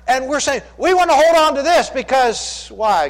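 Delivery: loud, high, pleading sermon; full-force preaching. Acted. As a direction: press the point hard on the listeners.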